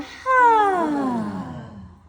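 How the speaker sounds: big audible sigh, falling; loud exhale sigh. Shown as a demonstration. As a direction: let the breath out on a long sigh. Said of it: "exhale on a sigh".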